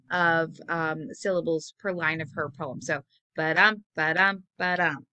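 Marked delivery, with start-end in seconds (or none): 3.3-5.1 "ba-dum" three times, stress on "dum"; three iambic beats. Shown as a demonstration. Teacher showing trimeter to students with ba-dums. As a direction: count out three beats evenly. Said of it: "three of those sets".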